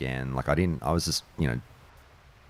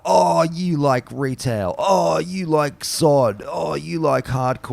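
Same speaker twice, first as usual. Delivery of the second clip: high, drawn-out taunting imitation; mock ridicule; 12 semitones higher, 5 dB louder, slower than usual. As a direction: imitate a snob jeering at other players' choices. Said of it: "they ridicule everyone and anyone".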